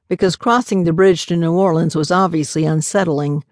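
ordinary delivery, nothing marked

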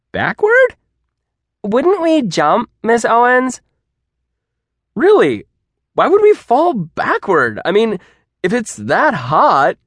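high, loud sceptical questions; incredulous student. A narrator's reading. Student disputing falling backward from fire, high and incredulous. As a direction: challenge it in sheer disbelief. Students doubt the teacher's logic.

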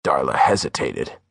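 ordinary delivery

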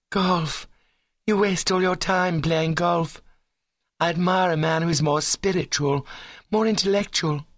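high, lofty ladylike reproach; high-minded girl disapproving; ambitious young woman, disdainful. Acted. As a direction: look down on the sport, voice held high.

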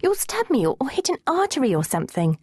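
high-pitched alarmed objection; panicky protest. A narrator's reading. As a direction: protest as if dreading the needle. Girl panicking about the needle.

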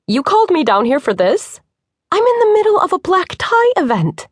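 incredulous, rising to a high pitch; how dare you drag me here; Bree, pulled from a gala, exasperated; indignant disbelief. A narrator's reading. Bree annoyed at being called.

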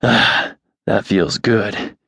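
slow, breathy purr of relief. Acted. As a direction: purr it with pleasure. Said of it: "he purred".

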